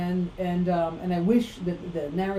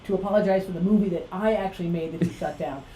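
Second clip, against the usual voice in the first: ordinary delivery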